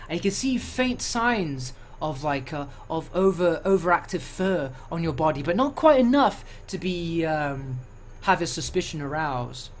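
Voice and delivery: ordinary talk, nothing marked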